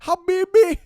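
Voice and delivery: high, very loud put-on Middle Eastern accent; mock Middle Eastern shout. Teller mimicking the minivan driver, loud Middle Eastern accent. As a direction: call it out as a hammed-up Middle Eastern man.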